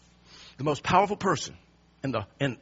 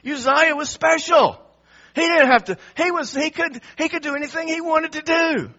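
mocking exaltation; 7 semitones higher, 4 dB louder than usual. Preacher mocking the king who was above the rules, exaggerated. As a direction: hammer up how special he supposedly is. Mocking the king's self-importance.

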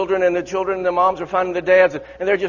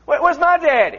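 put-on high, loud child's cry; little child searching for daddy; child calling for daddy; 8 semitones higher, 4 dB louder than usual. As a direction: call out like a lost child. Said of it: "this little child's".

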